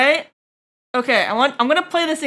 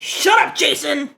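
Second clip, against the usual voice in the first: annoyed shout; 3 semitones higher than usual. Real, not acted. Streamer shouting down [name]'s track, annoyed yell. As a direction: cut the singer off with a yell.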